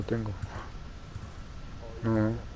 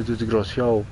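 ordinary delivery

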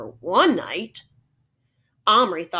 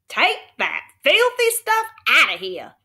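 put-on Texas accent shout; 7 semitones higher, 4 dB louder than usual. A narrator's reading. Cowboy snaps at the boy in Texas accent. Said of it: "he suddenly shouted in his strong Texas accent".

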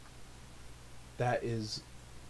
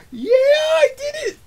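triumphant shout; 16 dB louder than usual. Real, not acted. Player wins a fight.

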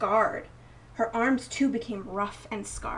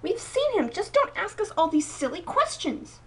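high protesting; 8 semitones higher than usual. A narrator's reading. Sisters protest.

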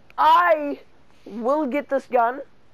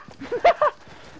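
high, loud yelping laugh; shouting laugh; 4 dB louder than usual. Real, not acted. Gamer hit by a sniper, high shouting laugh.

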